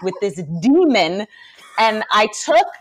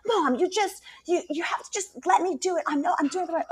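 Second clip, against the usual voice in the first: put-on higher, whiny nine-year-old insisting; whiny kid voice; 9 semitones higher, 6 dB quieter than usual. Mom imitating her son insisting he is right, higher whiny voice. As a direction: play the stubborn son pushing mom away.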